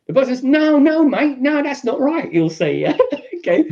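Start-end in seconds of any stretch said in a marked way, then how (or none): 0.4-2.3 high, upset protest; alarmed protest. Acted. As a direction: object in shock, pitched high. Victim protesting the intruder.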